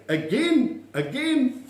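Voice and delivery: high-pitched "again", each one swooping; calling for a repeat. Shown as a demonstration. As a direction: call it out high, as if they weren't heard. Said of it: "I just want to say".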